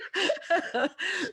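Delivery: high squeaky laugh; can't stop giggling; high giggle. Real, not acted. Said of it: "I'm laughing at my own joke here".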